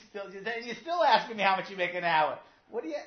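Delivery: loud, high-pitched outburst; exasperated father. Acted. Father fed up with the repeated question, loud exasperation. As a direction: shout it in acted disbelief at the kid.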